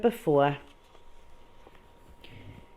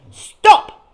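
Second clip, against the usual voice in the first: much higher and louder furious yell; explosive shout; 15 dB louder than usual. Acted. Mister Fame exploding at the disposal man. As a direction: explode with the word. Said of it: "he exploded at last".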